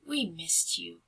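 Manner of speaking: soft, slow tender words; happy sigh. Acted. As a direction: say it tenderly, sighing. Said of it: "They sighed happily".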